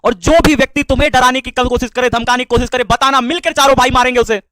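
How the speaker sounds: loud, heated threat on a brother's behalf; fierce protective anger; angry vow. Real, not acted. Vows to beat whoever threatens him.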